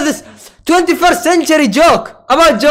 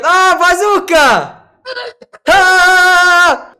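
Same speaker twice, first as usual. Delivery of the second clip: mock panic; 6 semitones higher, 3 dB louder, much slower than usual. Mock panic joke about a bazooka.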